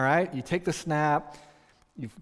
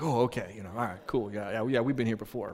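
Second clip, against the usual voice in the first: low, subdued nonchalance; playing it cool; 4 semitones lower than usual. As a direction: back down and act as if it's nothing. Boy acts cool after scolding.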